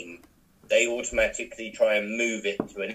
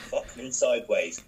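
normal talking voice

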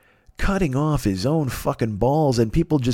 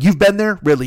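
raised, louder disbelief; pitched-up, challenging question; incredulous retort; 6 semitones higher, 5 dB louder than usual. Retold or imitated. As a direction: throw it back in mock disbelief. Incredulous retort to the excuse makers.

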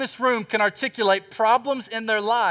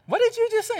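shocked disbelief. Retold or imitated. Husband hears flight is next Tuesday, shocked and high. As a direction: repeat it like you can't believe your ears.

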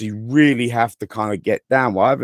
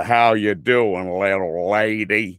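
slow cowboy drawl in an American accent; slower than usual. Shown as a demonstration. Showing how his American goes back to cowboy, slow drawl. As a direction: slip into a corny cowboy accent. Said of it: "it instantly goes back to".

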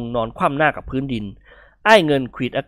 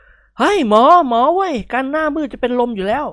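high, raised panicked call; calling out to the doctor; 11 semitones higher, 4 dB louder than usual. A narrator's reading. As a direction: shout across the fence for help. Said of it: "ร้องตะโกนถามในแพทย์หนุ่ม". (นิกรตะโกนเรียกหมอ ตื่นตระหนก)

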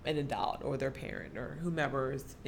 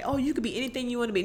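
mimicked encouragement; 7 semitones higher, 4 dB louder, much faster than usual. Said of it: "I hate when people say".

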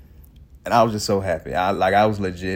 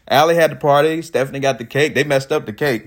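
usual speaking voice

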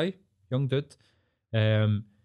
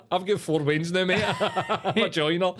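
Davey; 8 semitones higher than usual. Retold or imitated. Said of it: "Davey's like".

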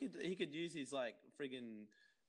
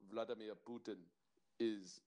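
low, halting Russian accent; 4 semitones lower than usual. Shown as a demonstration. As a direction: attempt a deep Russian, pausing between phrases. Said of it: "uh, Russian".

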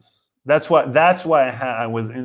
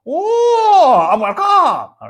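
put-on very high, loud accented whoop; hammy shrieked greeting in a put-on accent; God's comic accent; 7 dB louder, much slower than usual. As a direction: shout a silly accented welcome as God. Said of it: "if I was God, I would fuck with people".

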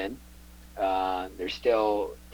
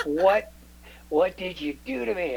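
mock indignant friend; 9 semitones higher than usual. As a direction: whine it like a betrayed buddy. Quoting Dale.

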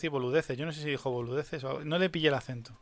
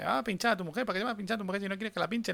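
imitating a fast talker, higher and softer; mock motormouth, pitched up; rapid-fire nagging; 5 semitones higher, 4 dB quieter, faster than usual. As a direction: rattle off the advice in one hurried, repetitive string. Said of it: "es que hablaba muy rápido".

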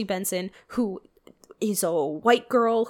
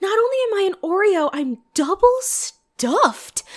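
higher, louder, quoting with glee; quoting the line; 9 semitones higher, 4 dB louder than usual. Retold or imitated. Echoing the movie line to a friend, gleeful mock quote. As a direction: rush it, then drag out double stuffed. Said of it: "Oh my god, yeah!".

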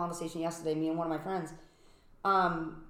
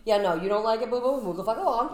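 sassy dismissive; 4 semitones higher, 6 dB louder than usual. Real, not acted. Defiant dismissal.

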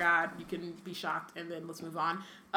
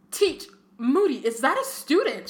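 high, loud, scandalised outburst; shrieked question; 6 semitones higher, 5 dB louder than usual. A narrator's reading. McGonagall finds Moody bouncing a student, shrieking. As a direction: voice leaps up, appalled. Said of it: "shrieked Professor McGonagall".

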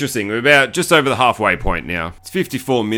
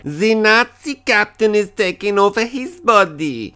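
high, slow put-on voice; mock alarmed announcement; 7 semitones higher than usual. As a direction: put on a high voice, drawing out each word. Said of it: "He's like".

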